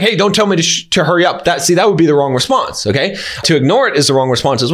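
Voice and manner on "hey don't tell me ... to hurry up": usual speaking voice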